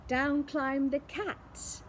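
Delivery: ordinary delivery, nothing marked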